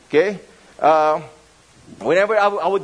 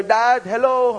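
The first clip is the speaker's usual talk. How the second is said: called out; 6 semitones higher, slower than usual. Retold or imitated. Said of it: "she would shout".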